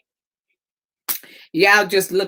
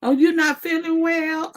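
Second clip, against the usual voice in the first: sweet pet voice; 10 semitones higher than usual. Real, not acted. Greeting Buddha in a high, sing-song pet voice.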